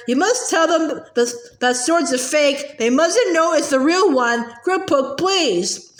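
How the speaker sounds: ordinary delivery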